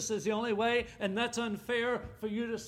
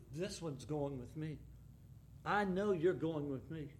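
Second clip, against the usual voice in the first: soft assurance; 6 semitones lower, 9 dB quieter than usual. Acted. Voicing God's personal assurance.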